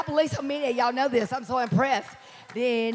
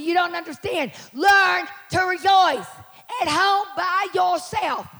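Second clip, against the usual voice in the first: loud, slow, raised preaching shout; full-force preaching; 8 semitones higher, 6 dB louder, slower than usual. Real, not acted. Preacher urging the church to rejoice, full-force preaching. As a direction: drive each phrase home to the congregation.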